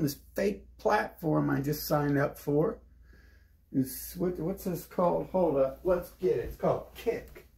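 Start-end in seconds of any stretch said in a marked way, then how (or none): none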